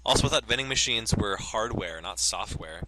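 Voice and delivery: ordinary talk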